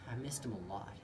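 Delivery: low, soft, downcast admission; subdued sadness. A narrator's reading. Child recalling the absent dad, quiet sadness. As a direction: let it drop soft with longing. Missing dad after divorce.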